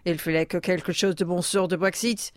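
smooth French delivery of the line. Pretend. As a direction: say it as a suave Frenchman. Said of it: "Try saying that in your native tongue".

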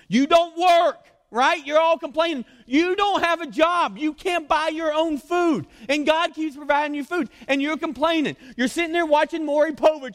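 high, loud, drawn-out berating; mock scolding rant. Preacher berates the complaining Israelites for not working, loud high scolding.

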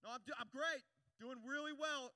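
very loud forced assurance; defensive insistence. Shown as a demonstration. Preacher demonstrating defensive person, loud insistence. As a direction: overinsist that everything is fine. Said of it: "they're, they're defensive".

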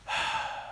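long, soft exhale; calming breath. Retold or imitated. Daughter beginning to calm down, soft calming exhale. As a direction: let out a breath to settle down.

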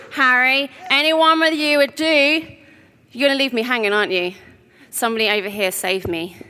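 ordinary delivery

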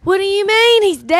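put-on Kansas accent. Dorothy reacts to Tin Man's death, Kansas accent. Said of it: "I can go into my Kansas accent".

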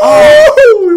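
very high, loud drawn-out "oh"; anguished wail. Acted. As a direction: wail in mock regret. Mock anguish over missed pun.

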